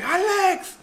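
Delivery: high, drawn-out yell; high-pitched shouted summons; retold loud yell. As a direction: call your brother's name hard. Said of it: "Yo le grité fuerte".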